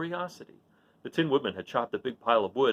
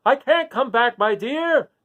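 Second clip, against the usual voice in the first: loud, higher call down from the sky; the wizard calling down to Dorothy from the basket; 9 semitones higher, 9 dB louder, slower than usual. A narrator's reading. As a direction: call down gently but loud. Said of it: "called Oz".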